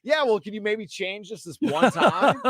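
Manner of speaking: laughing quote. Retold or imitated. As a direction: imitate a timid request through laughter. Laughing mimicry of pleading reporters.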